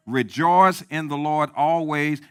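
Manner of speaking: slow, loud, weighty proclamation; emphatic scripture quote. Acted. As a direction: declare it slowly for the church. Preacher quoting scripture with force.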